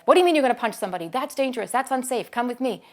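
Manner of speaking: fast, loud scolding teacher; teacher overreacting and scolding a child; reactive scolding. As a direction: rattle off a harsh reprimand. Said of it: "reacted to him with".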